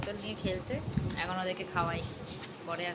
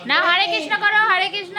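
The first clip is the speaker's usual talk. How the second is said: much higher, louder sing-song urging; 10 semitones higher, 11 dB louder than usual. Real, not acted. Urging baby to pray. (উঁচু গলায় আদুরে তাগাদা)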